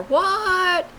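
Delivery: high, loud shouted what; hollered answer. Retold or imitated. Answering the call from the front door, loud high holler. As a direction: yell back from the front door. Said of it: "and I'd holler".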